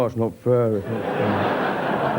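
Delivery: low, slow mock whine of protest; whining Morrises. Audience members caught wearing bracelets, mock sulky whine. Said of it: "will all go".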